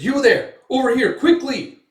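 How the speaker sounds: high, loud hurried call; urgent call. Retold or imitated. Hooded man calling Guts to follow, urgent loud call. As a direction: wave them over fast. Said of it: "He's like".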